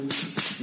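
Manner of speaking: unvoiced pss of a perfume spray; spray noise. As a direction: hiss out a spritz sound.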